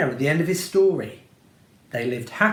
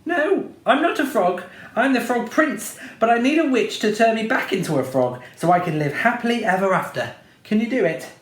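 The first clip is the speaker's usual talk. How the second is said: higher, rushed all in one breath; one long breath; 7 semitones higher than usual. A narrator's reading. Prince to the witch, rushing it all in one breath. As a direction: blurt it out without pausing. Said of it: "in one long breath".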